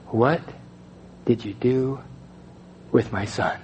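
low, soft, broken by long pauses; hushed, weighty retold question; solemn question. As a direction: pose it gravely, a few words at a time. Retells posing God's one solemn question.

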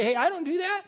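mock indignant I don't do that; indignant protest. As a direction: protest it loud like a stubborn kid. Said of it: "You say".

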